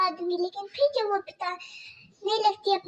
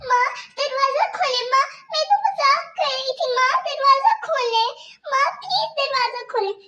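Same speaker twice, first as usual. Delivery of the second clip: put-on much higher, small pleading girl voice; pleading young girl; 6 semitones higher, 4 dB louder than usual. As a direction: Kajal begging her stepmother about stomach pain. Locked in bathroom pleading.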